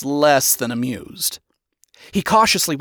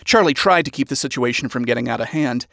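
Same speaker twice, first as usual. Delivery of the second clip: ordinary delivery, nothing marked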